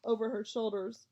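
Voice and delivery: ordinary delivery, nothing marked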